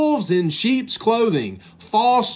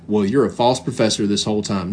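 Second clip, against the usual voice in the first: much lower, a flat scornful aside; mock dismissive verdict; 10 semitones lower than usual. Preacher parodying wrong counsel, low sneering mock. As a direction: drop the voice to sneer the line. Mocking counsel they should never give.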